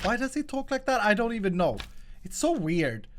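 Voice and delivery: ordinary talk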